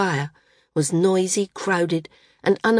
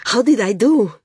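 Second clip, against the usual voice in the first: high, eager and loud; excited girl fishing for praise; breathless; 5 semitones higher, 6 dB louder than usual. A narrator's reading. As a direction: press for an answer breathlessly. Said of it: "she asked breathlessly".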